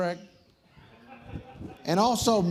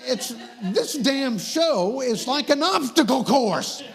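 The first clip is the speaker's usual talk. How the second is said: higher, raised comic rant; exasperated rant; 5 semitones higher than usual. Real, not acted. Host griping about an obstacle course show, exasperated rant. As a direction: complain over the laughter, exasperated.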